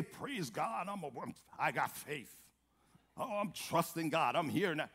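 soft, low, put-on piety; mock pious claim. Preacher mimicking what Hannah didn't say, soft mock piety. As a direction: toss off a pretend faith claim under the voice.

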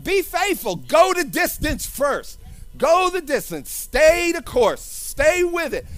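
preaching. Acted. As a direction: urge them on like a sermon climax.

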